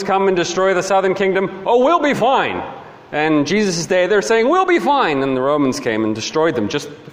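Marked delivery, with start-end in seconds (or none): 1.7-2.6 higher, breezy mock reassurance; mock complacency. Mimics complacent people before destruction. 4.5-5.2 high-pitched mock reassurance; mock complacency. Mimics complacent people before destruction.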